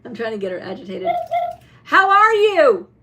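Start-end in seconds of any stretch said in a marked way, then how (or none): 1.8-2.9 high, loud, drawn-out how are you; loud greeting. Real, not acted. Owner loudly greeting her owl toy to rile it. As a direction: stretch the greeting out, bright and loud. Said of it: "I was a little too loud".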